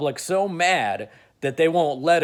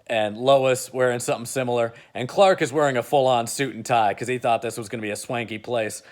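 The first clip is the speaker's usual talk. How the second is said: normal talking voice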